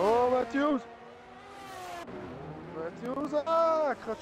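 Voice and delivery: sudden excited call; yelling a crash as it happens; excited shout. Real, not acted. Crash seen live. (cri excité)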